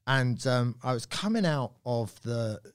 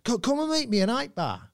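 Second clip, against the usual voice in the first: high-pitched, insistent punter voice; eager bloke; 8 semitones higher than usual. Retold or imitated. Bloke inviting the comedian to a bar, eager and high-pitched. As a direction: imitate a keen stranger pressing an invitation. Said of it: "He goes".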